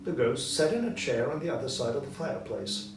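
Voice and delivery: ordinary delivery, nothing marked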